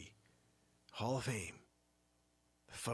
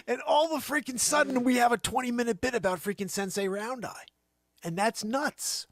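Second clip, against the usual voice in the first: retold excitement; 9 semitones higher, 7 dB louder than usual. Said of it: "I said".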